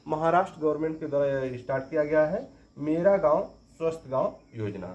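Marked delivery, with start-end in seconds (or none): none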